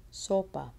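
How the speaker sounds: "Sopa" stressed on the first syllable. Shown as a demonstration. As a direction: lean on "So" in "Sopa".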